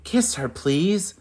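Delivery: high begging plea; a mouse pleading with the prince. Acted. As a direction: beg him to do it. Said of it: "Mouse's pleas".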